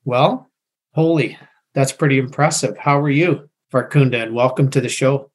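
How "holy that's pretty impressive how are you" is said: ordinary talk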